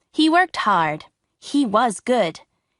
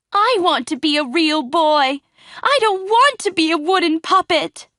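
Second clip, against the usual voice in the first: put-on higher, pleading, tearful boy; crying puppet; 7 semitones higher, 4 dB louder than usual. A narrator's reading. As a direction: beg through mock tears. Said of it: "Pinocchio started to cry".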